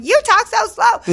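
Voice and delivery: hammed-up Californian retort to a Southerner; mock fast-talker retort. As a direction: squeal it back high and loud. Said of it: "And I'm like".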